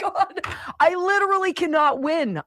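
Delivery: high, tearful mock wail; mock despair. Homeowner hearing the alarm yet again, high mock-crying despair. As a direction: whine it as if about to cry, hammed-up.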